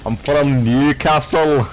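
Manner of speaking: slow mock Scottish protest; put-on Scottish accent. Mimicking friend insisting on his home town, slow Scottish accent. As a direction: draw it out as an affronted Scottish impression. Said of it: "my Scottish accent is so good".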